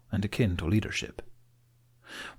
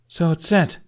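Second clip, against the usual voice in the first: higher, louder question; Astrid asking; 9 semitones higher, 7 dB louder than usual. A narrator's reading. Astrid asking Snotlout, higher and louder. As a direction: ask it brightly up high.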